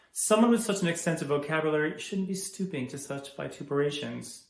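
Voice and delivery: loud, emphatic reprimand; stern scolding. Acted. School principal scolding a student for foul language, angry. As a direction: lay down the law, building to a loud finish.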